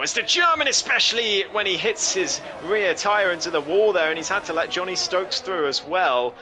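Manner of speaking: high, loud burst of race commentary; excited commentary. Real, not acted. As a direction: shout the crash call with urgency. Commentator reacts to a crash.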